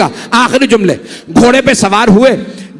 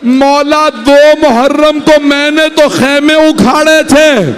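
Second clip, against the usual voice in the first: anguished plea; 5 semitones higher, slower than usual. A narrator's reading. Hur begs forgiveness, ashamed.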